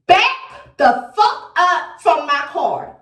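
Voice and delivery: slow, firm warning to back off; a stern threat through the car window. Retold or imitated. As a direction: spell it out slowly, no nonsense. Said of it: "I had to say it like that".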